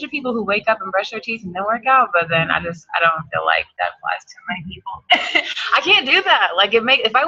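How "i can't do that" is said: laughing. Real, not acted. Laughing about brushing teeth.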